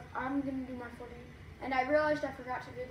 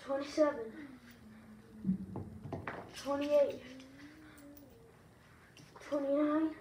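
ordinary delivery

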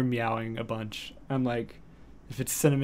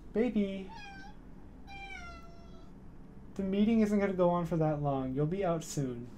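soft cat coaxing; 6 semitones higher, 7 dB quieter than usual. Real, not acted. Coaxing cat during stream.

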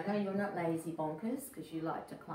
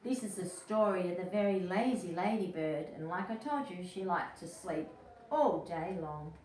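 plain reading voice

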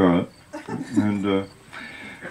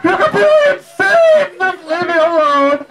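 exasperated daughter; 4 dB louder than usual. Retold or imitated. Daughter snaps back.

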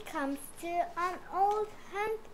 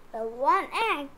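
ordinary delivery

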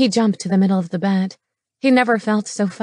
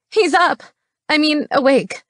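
high, loud alarmed woman; flustered scream; 8 semitones higher, 4 dB louder than usual. A narrator's reading. Woman announcing he has woken, flustered high cry. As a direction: call it out high, then correct yourself. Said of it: "she screamed".

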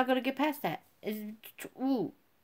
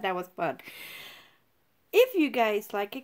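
usual speaking voice

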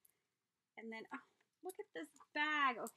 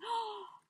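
high delighted gasp; surprised gasp; 10 semitones higher, 4 dB louder than usual. Real, not acted. Finding Gail's shared trims, high surprised gasp. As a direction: catch your breath in delight.

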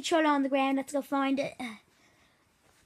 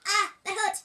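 normal talking voice

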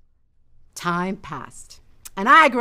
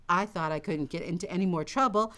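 ordinary delivery, nothing marked

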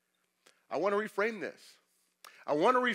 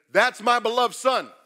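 loud, slow proclamation, higher; voice from heaven; 6 semitones higher, 9 dB louder, slower than usual. Acted. God proclaiming from heaven over Jesus, loud and slow. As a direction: announce it slowly like a voice from heaven.

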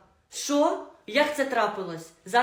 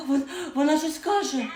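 high, loud excited call; excited shout; 5 semitones higher, 3 dB louder than usual. Acted. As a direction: shout it out, thrilled.

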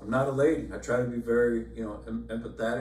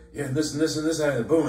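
couple arguing fast; 5 semitones higher, 8 dB louder than usual. Retold or imitated. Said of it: "They're like".

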